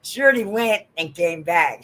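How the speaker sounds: normal talking voice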